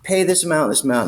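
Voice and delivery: high, overly chummy cop voice, fast on the sales pitch; butter him up like an old buddy; fake-friendly cop. Cop sweet-talking an inmate at the jail, high chummy voice. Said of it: "It's like we're old buddies".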